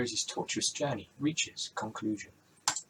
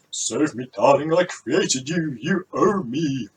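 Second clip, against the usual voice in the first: guilt-tripping dad; 3 semitones higher, 9 dB louder, slower than usual. Acted. Dad guilt-trips his daughter.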